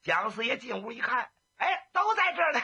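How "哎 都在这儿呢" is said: much higher, bright greeting breaking into a laugh; cheerful laugh. Acted. Jiang Ping walks in grinning at the gathered heroes, laughing. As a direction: burst in delighted to see everyone, laughing. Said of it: "满脸是笑容啊".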